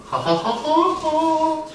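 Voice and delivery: slow, high-pitched fake ha-ha; mock laugh. Teacher asking why it wasn't erased, mock laugh.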